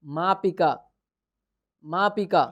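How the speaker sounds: द्विचक्रिका said syllable by syllable with a falling close; clear pronunciation. Shown as a demonstration. Teacher naming the cycle in Sanskrit, clearly. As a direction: spell out द्विचक्रिका in sound, then repeat it. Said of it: "इसको क्या कहते हैं".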